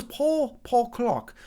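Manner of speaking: badly faked British accent; terrible English accent. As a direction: put on a hammy English accent for the name. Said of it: "terrible English accent, British accent".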